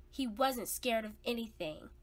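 plain reading voice

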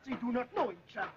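ordinary delivery

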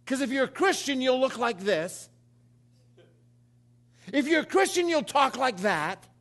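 loud and high, hammering each line; full-force preaching. Acted. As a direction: preach it out big to the crowd.

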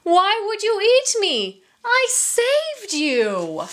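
high-pitched, incredulous protest; aghast. A narrator's reading. Merchant who saved the tiger protests, aghast. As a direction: protest in dismay, stretching the last words. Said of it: "gasped the merchant".

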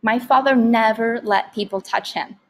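ordinary delivery

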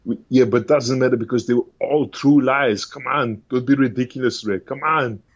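Austrian action-star impression; Arnold Schwarzenegger. Action star brushing off the evangelist's question, mock protest. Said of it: "Arnold Schwarzenegger doing the good person test".

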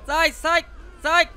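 high loud repeated get out; frantic shout. Real, not acted. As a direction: yell at it to move. Yelling at car to move.

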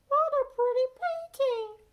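very high, sweet mom voice; Pete's mom voice. A narrator's reading. Mom admiring her son's painting, high and sweet. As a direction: squeak it up high like a cartoon mom. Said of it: "says Pete's mom".

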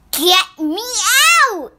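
put-on loud childish yell to be let out; cranky kid yelling to be freed; child's shout. A narrator's reading. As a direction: shout it like a fed-up little girl. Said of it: "and in the end I shouted".

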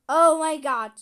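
blurted out loud; loud exclamation. Acted. Toy character reacts to an error, loud dismay. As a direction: shout it in dismay.

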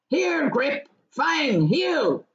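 high-pitched commands to dogs; calling out. Acted. Farmer calling his dogs back, crying out. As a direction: call the dogs to heel. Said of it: "he cried".

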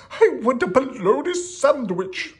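high, loud royal demand; hammed-up high kingly yell. A narrator's reading. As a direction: holler it like a tantruming cartoon king. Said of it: "yelled the king".

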